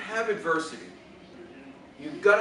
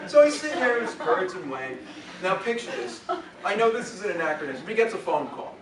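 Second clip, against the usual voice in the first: ordinary delivery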